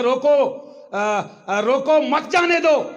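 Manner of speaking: high, drawn-out order with a break after روکو; urgent stop order. Acted. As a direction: call out to stop the truck. Policeman orders a truck stopped.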